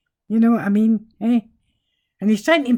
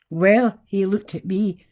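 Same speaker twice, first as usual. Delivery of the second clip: normal talking voice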